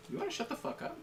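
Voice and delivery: high, incredulous mock comeback; mock retort. Snapping back at the online scolds, high and incredulous. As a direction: squeak it out high in disbelief. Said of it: "And it's like".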